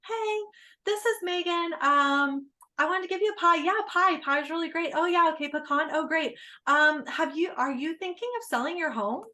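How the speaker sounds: awkward pie pitch. Pretend. Real estate agent clumsily offering a pie, awkward high pitch. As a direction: overdo the bright sales voice, high up. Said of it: "Like, that's weird as hell".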